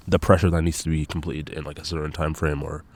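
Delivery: ordinary talk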